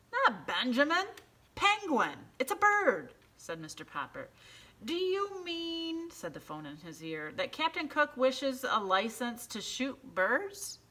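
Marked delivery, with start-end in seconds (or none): 0.1-3.1 high, loud exasperated correction; correcting a deaf clerk, much higher and louder. A narrator's reading. 4.8-10.8 high, slow clerk voice; high slow official misunderstanding; slow phone clerk. A narrator's reading.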